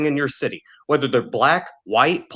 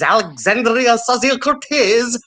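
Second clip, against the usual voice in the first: mocking sneer; 7 semitones higher, slower than usual. Mocking a politician's name.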